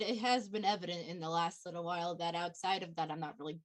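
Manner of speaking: low, subdued mumble of self-doubt; small, soft and downcast; self-deprecating admission. Acted. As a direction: confess it quietly, sinking away. Character doubts own worth.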